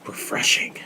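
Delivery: whispered, with barely any voice; confiding whisper; hushed aside. Real, not acted. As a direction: drop to a soft whisper for the word. Hushed punchline about the show.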